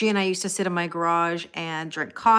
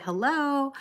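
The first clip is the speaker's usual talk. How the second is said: lilting, higher-pitched phone greeting; 7 semitones higher than usual. Shown as a demonstration. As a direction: answer the phone with a rising lilt. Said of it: "responsible for my phone voice".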